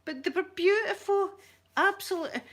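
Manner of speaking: high, loud adoring praise; squealy high admiration; gushing delight. Real, not acted. Gushing over the kangaroos.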